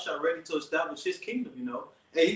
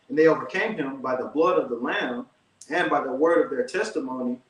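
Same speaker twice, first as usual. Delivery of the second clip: louder, steady Bible reading; scripture reading; 4 dB louder than usual. Acted. Reading Revelation to his brother, firm louder scripture reading. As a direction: read it out with weight, like from a pulpit. Reads verse eleven.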